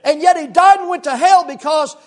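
loud, high, driving preacher's delivery; preaching at full force. Acted. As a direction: press the point home to the congregation. Preaching on the rich man.

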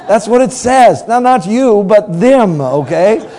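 loud, high playful scolding; loud teasing. Real, not acted. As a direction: wag a finger at the crowd, loud and high. Preacher teasing congregation, loud.

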